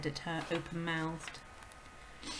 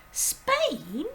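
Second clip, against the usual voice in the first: put-on high, urgent little girl's voice; a startled child calling out; high girl's cry; Matilda crying out; 9 semitones higher, 7 dB louder than usual. A narrator's reading. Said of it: "Matilda cried".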